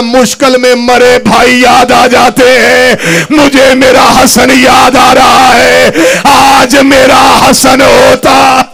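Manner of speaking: loud sung wail, slow and drawn out; crying out. Acted. Husain crying out for his brother, loud sung wail. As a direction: chant the lament at full cry, slowly. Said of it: "Hussain चीख मार के कहता".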